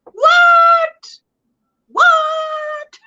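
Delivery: a high, loud squeal of disbelief; shocked what. Real, not acted. Host hears a viewer's on her ninth coffee, squealing disbelief. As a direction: yelp it out like you can't believe it.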